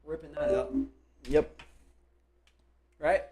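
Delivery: ordinary talk, nothing marked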